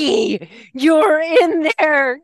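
high, loud and slow; amazed delight. Retold or imitated. Teacher realizing her student understands, amazed. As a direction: marvel at him, drawing it out.

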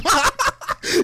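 high, bursting real laugh; laughing hard. Real, not acted. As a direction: crack up in a high cackle. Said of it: "I start dying laughing".

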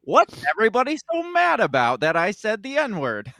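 playing an indignant, clueless morning host; indignant talk show guy. Imagined host baffled by the backlash, mock indignant. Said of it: "He's like".

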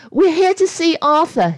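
quick, urgent. Acted. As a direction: blurt it out to stop them leaving. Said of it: "she said quickly".

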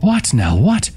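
confused questions leaping high at the end; bewildered, insistent asking; startled. A narrator's reading. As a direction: climb sharply on the last word. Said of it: "startled and disheveled".

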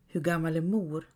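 usual speaking voice

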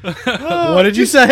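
slow words squeezed through laughter; laughing. Real, not acted. Laughing at friend's slip during the chip game. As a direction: ask while cracking up.

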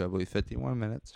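usual speaking voice

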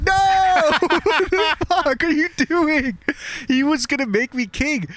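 high, hammed-up wail, cracking into laughter; mock outrage through laughter. David sees his ally's severed head: hammed-up high wail through laughter. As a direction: play the horror big and let the laugh break through.

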